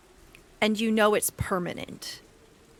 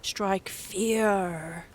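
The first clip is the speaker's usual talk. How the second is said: slow menacing creature; slower than usual. Acted. GM creature declares.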